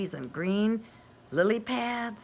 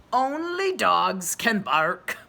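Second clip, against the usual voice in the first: slow, loud dog voice; a friendly dog making a point; 8 dB louder, slower than usual. A narrator's reading. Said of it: "the dog speaks".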